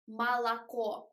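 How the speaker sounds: "молоко" as mah-lah-KO with full open A's; incorrect wide A. Shown as a demonstration. Showing students what we don't say, "молоко" with wide A's. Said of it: "it is incorrect because we don't say".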